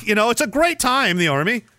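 ordinary talk, nothing marked

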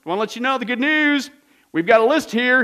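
loud, high barker calling out; hawking pardoner. Retold or imitated. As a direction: hawk it like a market crier. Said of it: "these guys went around and says".

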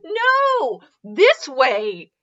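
high drawn-out "no", then firm correction; squealed objection from the hunchback servant; insistent henchman. A narrator's reading. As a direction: correct the master in a put-on voice. Hunchback corrects how to walk.

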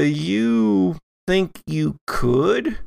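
ordinary talk

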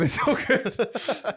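slow, laughing fake comfort; mock laughing reassurance. Reassuring the presenter about Millwall boys, laughing mockery. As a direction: laugh it off like it's nothing.

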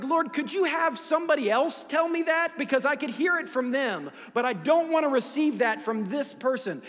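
mock complaint to God. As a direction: whine at God half joking. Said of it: "Has he ever done that to you".

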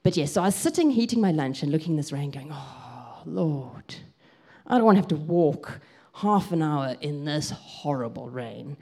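ordinary talk, nothing marked

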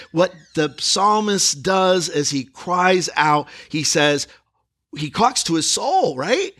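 usual speaking voice

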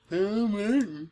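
high silly drawn-out sound. Pretend. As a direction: say it in a weird voice. Says name weird every time.

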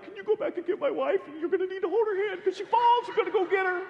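very high, strained mock-panic squeal; panicky voice. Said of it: "in my panicky voice".

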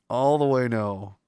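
ordinary delivery

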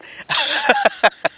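high bright laugh at her own joke; burst of laughter. Real, not acted. Preacher laughing at her bird joke, high burst of laughter.

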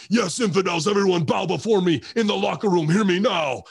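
pompous villain decree; megalomaniac rant. Retold or imitated. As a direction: lord it over the room. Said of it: "that'd be like me coming back from a match and be like".

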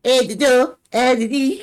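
high, loud mocking squeal; girly squeal. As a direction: squeal it like a helpless girl. Mocking girls acting helpless.

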